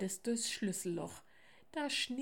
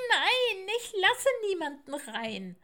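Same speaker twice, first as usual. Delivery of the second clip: high, loud, howled refusal; louder wailing; 12 semitones higher, 8 dB louder than usual. Acted. Little owl refuses all three visitors, loud wailing. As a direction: howl the no at full voice. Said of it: "heulte das Eulenkind noch lauter".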